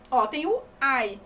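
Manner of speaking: usual speaking voice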